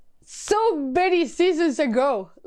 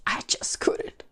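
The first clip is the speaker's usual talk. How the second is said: whispered, giving up on the show; defeated whisper; 5 dB quieter than usual. Real, not acted. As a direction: breathe it out, soft and unvoiced. Repeats phrase, second whispered.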